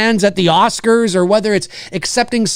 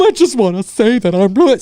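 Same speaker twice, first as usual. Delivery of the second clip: mock fake crying. Imitating Jamie Lee Curtis's weepy award speech, hammed-up crying. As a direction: overdo the award-speech tears. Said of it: "stop the fake crying".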